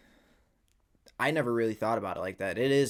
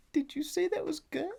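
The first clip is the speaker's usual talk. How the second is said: much higher, disbelieving mock customer voice; imitating the customer. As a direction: play the puzzled girl at the window. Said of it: "She's like".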